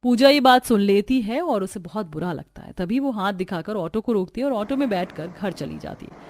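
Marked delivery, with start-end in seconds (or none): none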